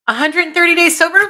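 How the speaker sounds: sharp gasp, then a high, loud, delighted question; thrilled disbelief at the good news; gasping surprise. Real, not acted. Reading a viewer's milestone with a gasp of real surprise.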